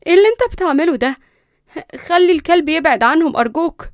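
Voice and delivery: high, loud alarmed plea; shocked. A narrator's reading. Cousin begging him to call off the dog, shocked. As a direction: protest in shock and plead. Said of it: "وليندا مصدومة".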